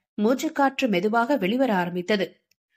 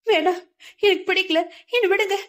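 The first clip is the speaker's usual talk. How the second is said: frightened refusal; 10 semitones higher, faster than usual. A narrator's reading. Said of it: "வெடுக்கென்று தட்டி விட்டாள்".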